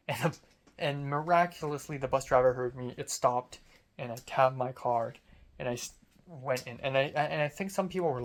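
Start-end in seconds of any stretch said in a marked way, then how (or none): none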